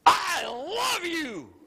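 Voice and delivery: high, drawn-out mock toddler exclamation; big, slow, stretched-out child's cry; small son's gushing declaration. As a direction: imitate a little boy bursting with love. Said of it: "look up, and he goes".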